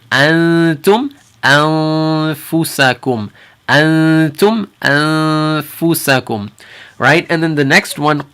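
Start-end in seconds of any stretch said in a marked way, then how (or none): 0.1-6.5 very slow "antum anfusakum" with a hidden nasal n; drawn-out "antum anfusakum", tongue at the front; ikhfa demo. Shown as a demonstration. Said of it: "If you can hear it".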